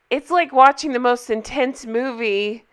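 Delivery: ordinary delivery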